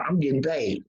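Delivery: ordinary talk, nothing marked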